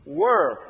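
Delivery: "Were" alone, loud, with falling pitch; stress on were. Shown as a demonstration. Preacher repeating the underlined word for the congregation, emphatic "were". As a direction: punch out a single emphatic "were". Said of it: "underline the word were".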